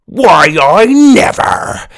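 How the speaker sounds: put-on a scandalised old pirate; outraged pirate.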